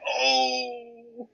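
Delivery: high sharp startled oh; shocked gasp. Retold or imitated. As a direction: yelp in mock shock. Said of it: "I was like".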